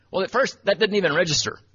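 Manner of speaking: ordinary talk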